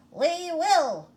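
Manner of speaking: high, loud squeaky mice answering together; little mice eagerly promising in unison; shrill chorus. Acted. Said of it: "cried all the mice in a shrill chorus".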